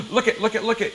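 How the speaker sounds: sudden gasp, then a quick repeated look it; startled look it; alarmed gasp. Real, not acted. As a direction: call attention in alarm.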